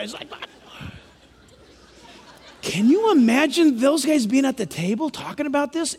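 ordinary talk, nothing marked

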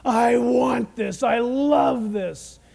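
slow, loud, greedy declaration; gloating Satan. Acted. Satan craving worship through the Antichrist, gloating slowly. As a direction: savour every word, loudly and slowly. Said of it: "Satan declares".

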